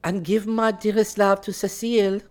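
high, sugary parting shot; sting him with sweetness; sweetly barbed. Acted. Valentine sends Clifford off with a sweetly barbed, high-voiced jab. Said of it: "wincing when she added".

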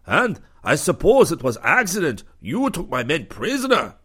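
angry commander growling at the lieutenant; growling accusation. A narrator's reading.

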